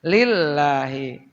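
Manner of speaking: Lillahi with the lam held long and doubled. Shown as a demonstration. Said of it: "lamnya ada tasdid". (lam ditekan panjang pada "Lillahi")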